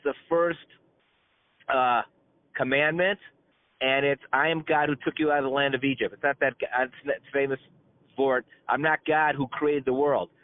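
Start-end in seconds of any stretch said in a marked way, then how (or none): none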